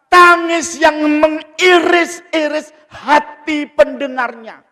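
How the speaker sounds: high, loud sermon cry; impassioned preaching. Real, not acted. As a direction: raise the voice and stir the congregation. Preaches at full force. (ceramah berapi-api, suara tinggi dan lantang)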